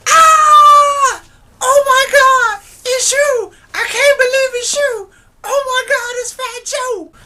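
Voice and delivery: very high, loud fangirl squeal; ecstatic fangirl. Retold or imitated. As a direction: squeal like a starstruck girl meeting an idol. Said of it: "she's like".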